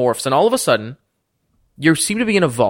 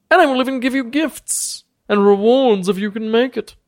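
sly bargaining lord; 8 semitones higher than usual. Acted. Lord warns the party and offers a trade, grand and much higher. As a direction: weigh each word like a crafty old lord. Thaddeus lets them go.

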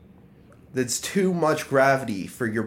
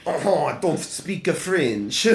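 higher, slow hammed-up French accent; mock French accent; 5 semitones higher than usual. Teasing the co-host's retold denial, mock French accent. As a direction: put on a hammy French accent, drawn out. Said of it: "You sound French as shit".

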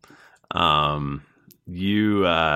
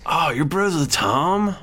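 slow, higher surfer-bro voice; stoner voice; 8 semitones higher than usual. Acted. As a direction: stretch it out like a bro. Uthgar voiced on the phone.

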